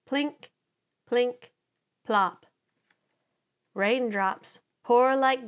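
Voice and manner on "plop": plain reading voice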